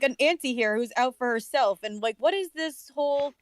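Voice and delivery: ordinary delivery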